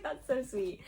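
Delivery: quietly gushing fan; mock swooning. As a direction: melt over the sweet line. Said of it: "you're gonna be like".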